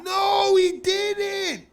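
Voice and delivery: very high, loud scandalized yell; mock outraged shout. Listener hearing the sewer rats insult, mock outrage. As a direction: shriek in pretend disbelief.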